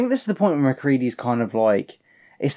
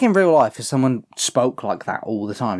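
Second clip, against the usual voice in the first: normal talking voice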